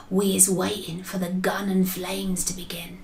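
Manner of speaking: soft, breath-only whisper. A narrator's reading. BFG to Sophie as they hide and wait, whispered. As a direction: whisper it as if hiding nearby.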